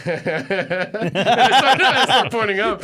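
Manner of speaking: loud, high words bursting through laughter; hammed-up laughing. Traveler mocks the guard by pointing up at the announcement, laughing. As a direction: act out laughing in someone's face. Retells laughing in official's face.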